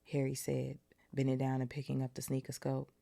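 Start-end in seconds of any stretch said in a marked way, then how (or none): none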